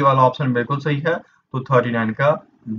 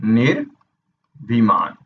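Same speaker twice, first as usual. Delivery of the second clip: 1 slow spelling-out; 4 semitones lower, much slower than usual. Shown as a demonstration. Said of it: "ये वाला ढूंढना है आपको".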